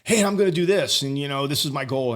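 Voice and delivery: pumped-up boast of his goal; eager announcement. Retold or imitated. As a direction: announce it like you're hyped to tell everyone.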